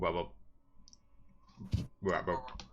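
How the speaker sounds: imitated frog call; little froggy ribbit; frog croak. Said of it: "It's the frogs".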